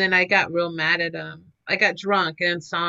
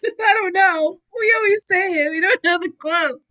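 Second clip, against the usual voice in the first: laughing while talking; 9 semitones higher, 5 dB louder than usual. Real, not acted. Laughing about quote.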